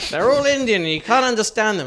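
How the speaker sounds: a hint of a brash audience woman; mock heckler woman. Comic retelling a heckler at a Great Yarmouth gig, lightly mimicked. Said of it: "She went".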